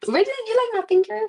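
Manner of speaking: high, loud surprised question; pleasantly taken aback. Real, not acted. Reacting to a viewer's comment.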